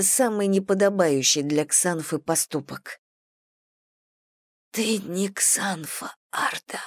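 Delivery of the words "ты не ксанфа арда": unvoiced, slow final whisper; dying whisper. A narrator's reading. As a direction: breathe out her last words. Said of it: "что смогла прошептать Джоан".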